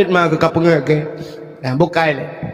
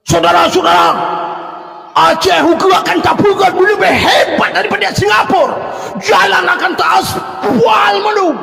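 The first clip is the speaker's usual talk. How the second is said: mock rally orator; 10 semitones higher than usual. Preacher mimicking a politician at a rally, mock fiery shout. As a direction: rouse the crowd like a campaign speaker.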